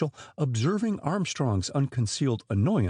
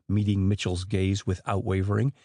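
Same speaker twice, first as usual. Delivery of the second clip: usual speaking voice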